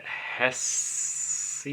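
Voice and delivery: "Hesse" as one syllable, HESS, with a long hissed s; trying a pronunciation. Shown as a demonstration. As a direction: draw out the final s of "Hesse", softly. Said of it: "how's the bookstore owner pronounce it".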